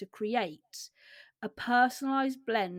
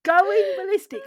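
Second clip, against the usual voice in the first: high and much louder; loud amused exclamation; 9 semitones higher, 10 dB louder than usual. Real, not acted. Repeating favourite anger stage to co-host, loud and amused. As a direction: belt it out in delight.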